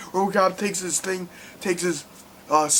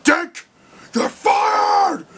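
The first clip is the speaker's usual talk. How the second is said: high, loud yell; furious firing; 4 dB louder, much slower than usual. Retold or imitated. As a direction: bellow the firing at him. Boss firing a traitor.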